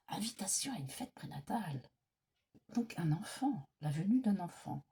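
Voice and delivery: soft unvoiced whisper to herself; whispered repeat. Real, not acted. Reader whispers the card meaning back to herself, whispering. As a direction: mouth it quietly as you take it in.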